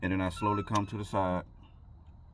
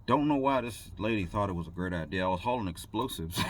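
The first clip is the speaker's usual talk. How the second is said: ordinary talk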